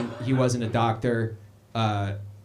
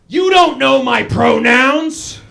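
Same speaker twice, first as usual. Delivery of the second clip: loud, slow, much higher outraged shout; indignant yell; 10 semitones higher, 11 dB louder, much slower than usual. Retold or imitated. Dad yelling back at a stranger in the hallway, loud and slow. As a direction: bellow it like he's been insulted. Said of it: "and he went".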